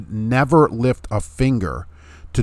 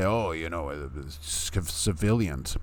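softer, lower pitiful mock sigh; mocking whimper; 5 semitones lower, 8 dB quieter, slower than usual. Vlogger mimicking critics who fret about civilians, soft mock whimper. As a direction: sneer at them with a limp little whine. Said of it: "when people come out and say".

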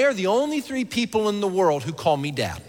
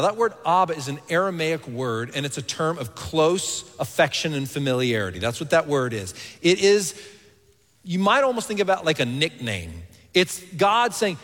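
usual speaking voice

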